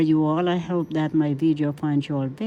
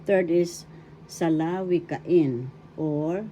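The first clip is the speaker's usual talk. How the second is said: ordinary talk, nothing marked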